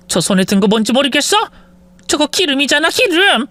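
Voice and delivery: put-on high, frantic older woman; panicked mother-in-law. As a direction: rush to calm her and scold the son. Panicked mother-in-law scolds son.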